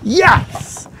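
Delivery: high, loud cheering; excited. Real, not acted. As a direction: burst out with joy.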